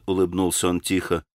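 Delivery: plain reading voice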